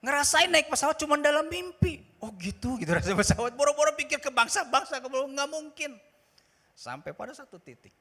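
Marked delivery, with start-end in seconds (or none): 0.0-6.0 loud, high, driving sermon voice; fervent pulpit delivery; full-force preaching. Acted. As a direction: preach it out with fire.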